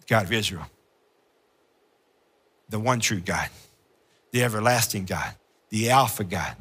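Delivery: low, soft reverent list; name God's titles quietly; hushed worship roll call; worshipful litany. Acted.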